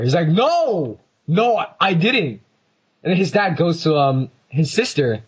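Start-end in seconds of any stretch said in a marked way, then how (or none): 0.3-2.4 high-pitched protesting no; retold defensive teen, pitched up; indignant denial. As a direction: whine out a mock denial. Nick denies it to dad.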